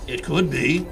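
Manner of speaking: low dark voice; deep sinister voice. Acted. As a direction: growl it low. Second shadow voice.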